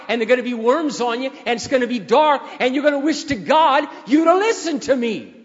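loud, high fire-and-brimstone warning; dramatized passion. Retold or imitated. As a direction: build to a shouted threat of damnation. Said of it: "I'm dramatizing the situation to project on an audience".

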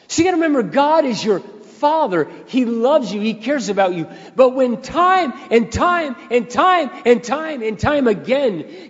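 impassioned preaching. Real, not acted. Preacher at full force on God's love.

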